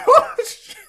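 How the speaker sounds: high-pitched words squeezed through laughter; helpless laughing. Real, not acted. Trying to get his spirit back, helpless laughing. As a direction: keep cracking up as you swear.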